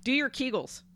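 high pitched bossy instruction; high bossy order. Pretend. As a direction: chirp the order.

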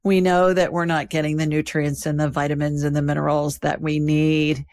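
calm, even sponsor read. Acted. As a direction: read the ad steadily and clearly. Host reads sponsor ad.

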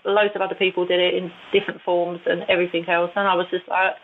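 usual speaking voice

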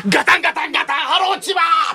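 crashing noises into a high shouted greeting; desperate broadcast shout. Retold or imitated. Comedian re-enacting live broadcast as the house collapses, desperate high shout. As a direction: yell the greeting over the crashes. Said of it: "命がけで".

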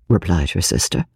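ordinary delivery, nothing marked

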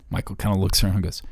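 ordinary delivery

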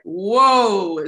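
loud, drawn-out whoa; mock astonishment. Reacting to Australians rapping in Southern Atlanta accents, mock astonished.

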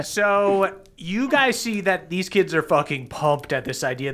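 ordinary talk, nothing marked

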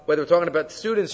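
ordinary talk

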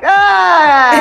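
high loud mock cry of despair; hammed-up loud cry; mock wail. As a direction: wail it in jest. Mock wail about clingy son.

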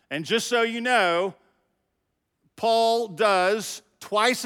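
rallying preaching. Acted. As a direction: fire up the fellas from the pulpit. Turns to the men, rallying.

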